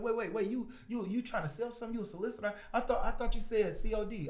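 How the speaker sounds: high-pitched, halting double-take; imitated puzzled homeowner. Homeowner realising the stranger is a salesman, high puzzled questioning.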